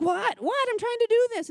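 high, loud, harried retort; frustrated snap. Retold or imitated. Mom snapping at the bus driver, loud and frazzled. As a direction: snap back while wrestling a kicking toddler.

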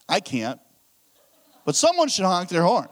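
ordinary delivery

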